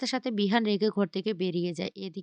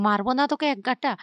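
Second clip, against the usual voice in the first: narrated angry threat, higher and louder; 4 semitones higher, 7 dB louder than usual. A narrator's reading. Bihan threatening to hit, angry, in narration. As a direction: raise pitch and volume for a mild angry threat. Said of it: "রেগে বলতে লাগলো".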